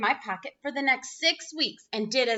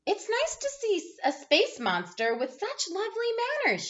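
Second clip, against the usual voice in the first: pleased mother; 9 semitones higher than usual. A narrator's reading.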